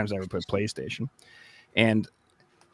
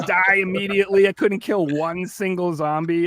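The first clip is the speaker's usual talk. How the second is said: ordinary delivery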